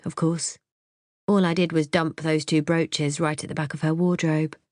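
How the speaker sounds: ordinary delivery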